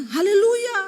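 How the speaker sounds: loud, high hallelujah hurled like an insult; mock quarrelling hallelujah. A church couple imagining their fights, mock quarrelling shout. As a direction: shout a pious word as mock abuse. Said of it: "kalau ribut ngomongnya".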